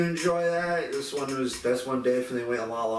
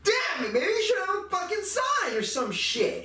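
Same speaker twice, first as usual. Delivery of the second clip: exasperated rant. Real, not acted. Complaining about the open back area, indignant rant. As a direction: vent your outrage at the store. Ranting at the store manager.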